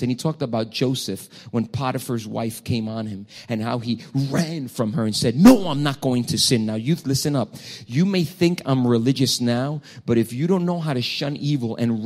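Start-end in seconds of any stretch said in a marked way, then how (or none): 5.3-6.7 loud, acted-out cry of refusal; emphatic refusal. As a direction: call it out as Joseph fleeing temptation. Preacher acts Joseph refusing temptation.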